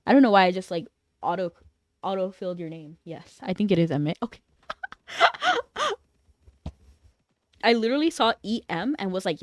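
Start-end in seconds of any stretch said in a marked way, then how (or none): 4.7-5.9 squealing high-pitched laugh. Real, not acted. Streamer laughs at misnaming a chatter, high squeal.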